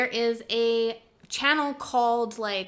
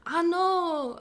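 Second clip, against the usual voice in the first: put-on Jamaican accent; 6 semitones higher than usual. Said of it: "do, like, a Jamaican accent".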